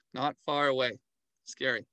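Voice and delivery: usual speaking voice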